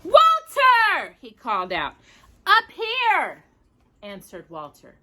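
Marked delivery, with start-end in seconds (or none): 0.0-1.1 loud high call. Acted. Brother calling out from below. 2.4-3.4 high call from above; calling back. Acted. Walter calling from the ceiling.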